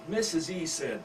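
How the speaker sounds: ordinary delivery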